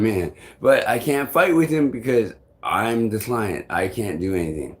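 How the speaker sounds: normal talking voice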